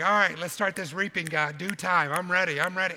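loud, high, mock-eager demand; eager impatience. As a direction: act pumped up and raring to start. Acting out impatience to reap.

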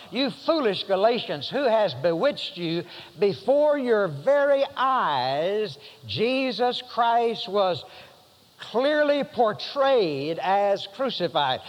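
slow, weighty rebuke of foolish Galatians; Paul's rebuke read out. Acted. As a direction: let each word land like a reproach. Reading Paul's rebuke aloud.

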